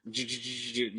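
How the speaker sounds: quiet stuttering engine noise; soft, choppy engine imitation; imitated car failing to start. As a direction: sputter like a starter motor. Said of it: "the car doesn't wanna start".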